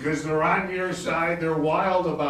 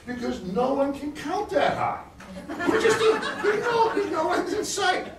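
much higher, laughing through words. Real, not acted. Laughing about nuclear costs, laughing. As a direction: laugh it out, pitch climbing. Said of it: "laughing while talking".

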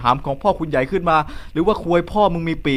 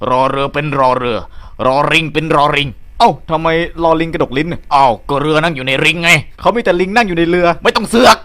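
other comic voice. Acted. Second comic figure speaks.

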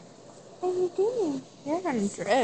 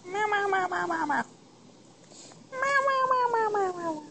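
high, playful cat meows; imitated meows; 6 semitones higher, faster than usual. Owner meowing at her cat to wake it, high and playful. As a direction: meow at the cat like a kitten.

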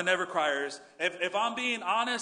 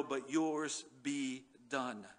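slow surrender; 7 semitones lower, 8 dB quieter, much slower than usual. Retold or imitated. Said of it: "he cried out to his Father".

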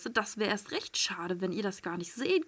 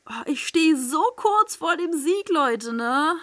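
loud, higher, thrilled announcement; excited; 6 semitones higher, 6 dB louder, slower than usual. Real, not acted. Streamer to viewers, loud excited near victory. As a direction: tell everyone you're about to win.

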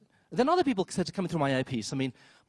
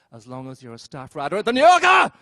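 sudden shout; 10 dB louder than usual. Retold or imitated. Parents telling their child, sudden loud shout. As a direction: snap from sweet to a loud demand.

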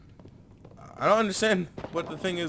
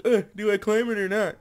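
lightly voiced dithering character; a hint of mock hesitation; 6 semitones higher, 5 dB louder than usual. Player mocking the hesitant character, lightly voiced. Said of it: "She's like".

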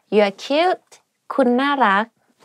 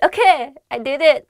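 triumphant relief; 5 semitones higher than usual. Real, not acted. Teacher finishing the longest city name, triumphant. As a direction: celebrate getting through it.

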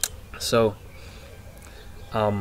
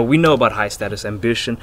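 ordinary talk, nothing marked

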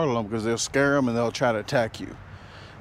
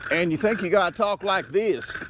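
pitched higher, hamming up a country twang; lightly put-on woodsman talk, higher; mock backwoods voice; 7 semitones higher than usual. Showing how folks talk out in the woods, in a hammed-up higher voice. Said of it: "okay, I'll stop".

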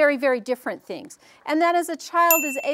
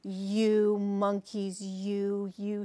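very slow, low, measured scolding; scolding peddler; 5 semitones lower, 6 dB quieter, much slower than usual. A narrator's reading. Peddler first scolds the monkeys in the tree, slow scolding. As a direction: draw out each word in a restrained reprimand. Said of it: "Finally, he spoke to them".

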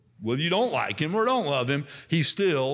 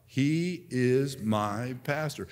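"He is my pastor" said slowly, each word given its weight; slow declaration; much slower than usual. Shown as a demonstration. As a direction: say it slowly and deliberately. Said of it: "Say it out loud".

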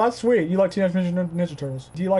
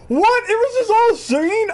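high, shocked disbelief; 11 semitones higher than usual. Real, not acted. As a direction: sound stunned by the twist. Shocked at the twist.

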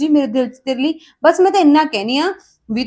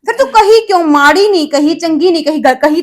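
angry retort; 3 semitones higher, 4 dB louder than usual. Acted. Sister-in-law snapping back at her husband's sister, angry. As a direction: snap back in anger.

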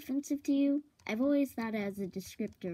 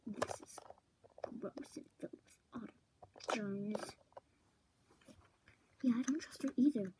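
hushed, quick conspiratorial whisper; secret whisper; 6 dB quieter than usual. Real, not acted. Friends plot in secret about the visitor, whispering. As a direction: whisper fast so she won't hear. Secret talk behind her back.

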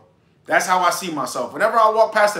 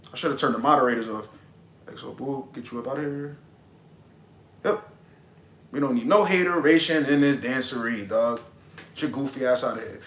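muttered dismissal; 8 semitones lower, 7 dB quieter than usual. Real, not acted. Kicking a heckler off.

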